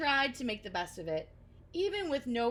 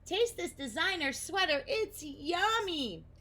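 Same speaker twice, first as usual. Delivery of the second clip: higher, slow "yummy"; greedy glee; 9 semitones higher, 4 dB louder than usual. Acted. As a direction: Nibble offering Oscar a tasty sweater, delighted. Moth offering sweater.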